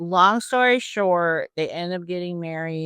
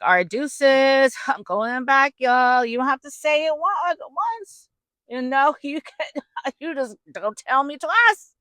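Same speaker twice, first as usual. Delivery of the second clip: high, laughing kiss-off; breezy high-pitched goodbye, giggling through it; gleeful quitter; 7 semitones higher than usual. Acted. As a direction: play someone delighted to be let off. Voices departing daughter-in-law, gleeful.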